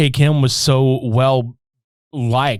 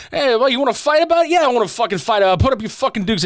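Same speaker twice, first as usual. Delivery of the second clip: higher, fast back-and-forth squaring up; mock fight challenge; 9 semitones higher, much faster than usual. Two movie guys daring each other to fight, mock challenge. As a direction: trade hammed-up challenges at speed.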